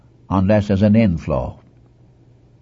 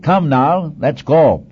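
lightly acted summons, higher pitch; a brisk, raised-pitch command to set out; God urging Moses; 6 semitones higher, 3 dB louder, slower than usual. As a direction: urge him along, lightly in role. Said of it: "When God said to Moses".

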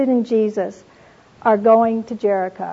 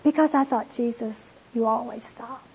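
softer, tender and moved; a touch of real tears recalling the verse; moved, near tears; 6 dB quieter than usual. Real, not acted. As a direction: speak it as if holding back tears. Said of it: "I just began to weep".

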